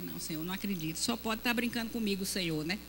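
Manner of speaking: very low, soft plea; despairing prayer. Retold or imitated. Wife hearing of the Amazon posting, low soft plea. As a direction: mutter in disbelief to God.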